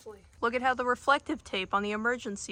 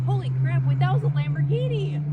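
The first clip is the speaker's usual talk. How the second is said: loud, low excited yell; excited shout; 8 semitones lower, 14 dB louder than usual. Real, not acted. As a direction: blurt it out loud in surprise. Sees a passing Lamborghini.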